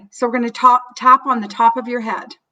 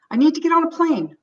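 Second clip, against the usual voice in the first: higher, urgent plea; mildly acted urgency, pitched up; urgent panic; 6 semitones higher than usual. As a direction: push it out like you must leave now. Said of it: "your own sense of panic".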